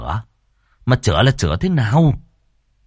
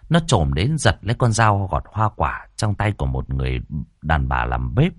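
ordinary delivery, nothing marked

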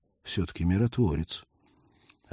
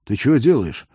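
hoarse wheeze; 3 semitones higher, 7 dB louder than usual. Acted. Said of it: "прохрипел парень".

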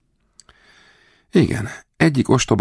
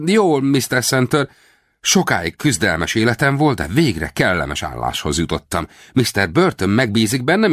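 high, ingratiating storyteller voice; Rawlins, sly actor; 8 semitones higher than usual. A narrator's reading. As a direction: chatter eagerly to sell the lie. Rawlins playing his part. (magas, behízelgő hang)

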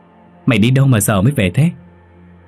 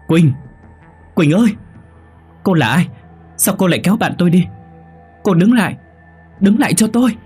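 high, urgent cry after someone; straining loud call; 10 semitones higher than usual. Acted. Girl calls after friend being dragged away, straining loud call. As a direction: strain to stop them leaving. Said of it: "Quyên gồng mình gọi lớn".

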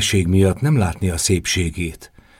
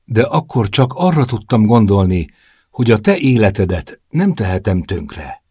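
plain reading voice

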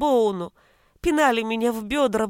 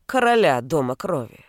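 ordinary delivery, nothing marked

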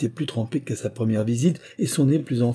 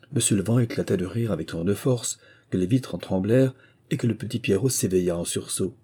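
usual speaking voice

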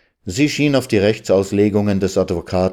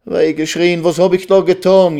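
high, raised lament; wailing; 10 semitones higher, 5 dB louder than usual. Acted. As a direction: wail in self-reproach. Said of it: "jammerte Resel". (lautes Jammern)

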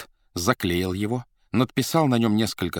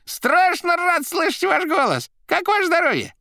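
high, loud rattling jester voice; fawning high rattle; Koroviev voice; 6 dB louder than usual. A narrator's reading. Koroviev on phone.

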